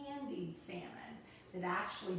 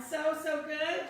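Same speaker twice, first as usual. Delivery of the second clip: excited rave; 8 semitones higher, 9 dB louder than usual. Real, not acted. Host raves over salmon bite.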